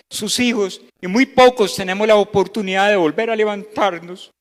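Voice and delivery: rising sermon call to repent; preaching at full force. Acted. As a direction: urge the congregation to stop and think.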